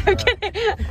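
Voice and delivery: laughing. Real, not acted. Laughing while joking to camera.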